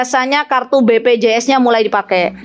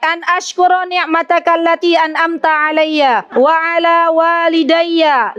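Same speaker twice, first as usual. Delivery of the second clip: recited prayer; 4 semitones higher than usual. Acted.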